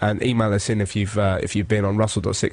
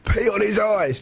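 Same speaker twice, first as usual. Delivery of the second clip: high-pitched mock jeer; crowd heckle; 9 semitones higher, slower than usual. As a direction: egg the torturer on like an onlooker. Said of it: "people started shouting out".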